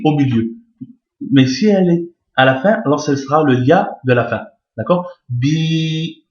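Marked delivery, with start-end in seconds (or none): 5.3-6.3 "Bi" with a long, level vowel; prolonged i. Shown as a demonstration. Teacher showing ya at the end, "Bi" drawn out long. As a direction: hold the i of "Bi" well past normal length. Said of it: "il faut prolonger le i".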